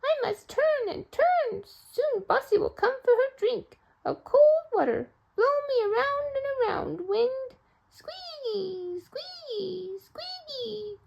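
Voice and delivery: very high, slow windmill voice. Acted. Storyteller speaking as the windmill waiting for Bossy. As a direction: creak out the windmill's lines. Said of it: "said the windmill".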